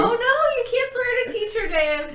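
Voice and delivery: mock scolding.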